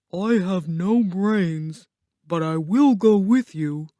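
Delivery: slow, put-on scarecrow voice; willing Scarecrow. Scarecrow offers to join Dorothy, slow character voice. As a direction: draw the words out as a simple character.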